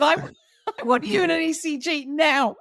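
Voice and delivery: a doctor half laughing, insisting; laughing urgency. Retold or imitated. Doctor ordering her to an ECG, laughing as he insists.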